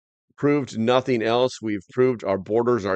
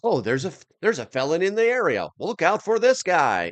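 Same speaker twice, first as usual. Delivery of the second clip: mock surveillance announcement; 8 semitones higher than usual. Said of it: "it was like".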